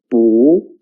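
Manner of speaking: 不 repeated with a clear rising tone; second-tone 不. Shown as a demonstration. Teacher repeating the changed tone, 不 rising. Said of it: "will change into the second tone".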